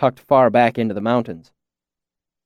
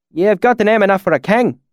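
higher-pitched imitation of rustic valley accent; put-on valley dialect; 8 semitones higher, 3 dB louder than usual. A narrator's reading. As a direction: slip into the swineherd's country dialect. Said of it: "my sudden change in dialect".

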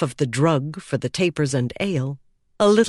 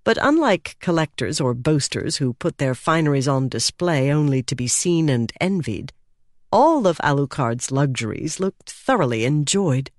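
ordinary delivery

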